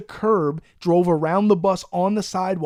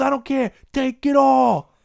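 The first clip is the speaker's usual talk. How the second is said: mock angry cop, much higher and louder; hammed-up fed-up officer barking orders; aggravated cop; 7 semitones higher, 4 dB louder, slower than usual. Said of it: "another aggravated fucking cop".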